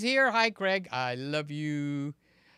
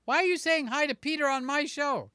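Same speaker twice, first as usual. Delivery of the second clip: high, raised mock complaint; mock indignation; 8 semitones higher, 4 dB louder than usual. Streamer voicing a rival host's annoyance, mock indignant. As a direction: imitate an annoyed host, pitched up. Voices Sly's annoyed reaction to viewers.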